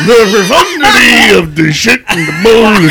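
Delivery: very loud, high, wailing parody; exaggerated mock-drunk bellow; drunk Bill Cosby. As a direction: shout it in a hammed-up drunken howl. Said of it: "You sound like a drunk Bill Cosby".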